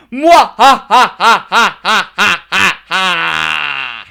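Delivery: loud slow villain cackle; evil laugh. Acted. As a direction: cackle like a mad scientist. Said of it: "from the Jacko Pizza".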